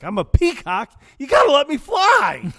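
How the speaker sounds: high, loud, desperate plea; loud dramatic shout. Retold or imitated. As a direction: cry it out to the sky. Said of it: "I'll just be like".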